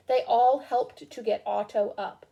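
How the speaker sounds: usual speaking voice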